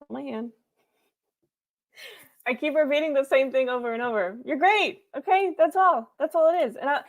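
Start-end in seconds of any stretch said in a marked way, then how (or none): 4.4-6.8 emphatic encouragement. Real, not acted. Emphatic pep talk to viewer.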